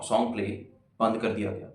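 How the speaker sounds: usual speaking voice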